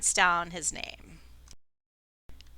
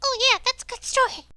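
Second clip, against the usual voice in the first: high, quick little imp; 5 dB louder, faster than usual. Acted. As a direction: chirp it back like the tiny imp. Imp agrees in its voice.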